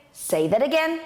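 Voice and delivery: demonstrated challenge, as if picking a fight; confrontational challenge. Shown as a demonstration. Teacher shows someone wanting to start a fight, challenging. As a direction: say it like a dare to repeat it. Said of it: "someone wanting to start a fight".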